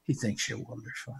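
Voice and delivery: hushed whisper of the same line. Real, not acted. Preacher whispering God's love to the listener, hushed. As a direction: drop it to a whisper to draw them in.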